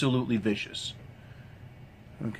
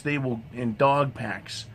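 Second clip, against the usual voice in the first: normal talking voice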